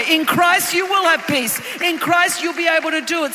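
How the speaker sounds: loud, rising call and response cadence; pounding sermon climax; full-force preaching. Acted.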